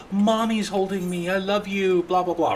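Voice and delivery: gasp, then high imitation of a thrilled baby; high-pitched mock excitement after a gasp; excited baby. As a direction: play an overjoyed little one adoring mommy. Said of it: "she'd get so excited".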